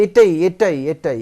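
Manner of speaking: each এটাই punched out very loud; loud repeated stress on এটাই, easing on the last; forceful emphasis. Shown as a demonstration. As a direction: hammer এটাই insistently. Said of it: "জোর দেওয়া বুঝায়".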